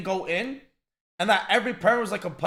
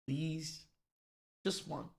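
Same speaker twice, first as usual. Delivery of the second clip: quiet plea; 4 semitones lower, 14 dB quieter, much slower than usual. Real, not acted.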